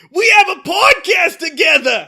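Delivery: put-on high, loud Boston-accented shout; hammed-up Boston plea to a friend. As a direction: yell it in a mock Boston accent. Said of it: "a Boston accent".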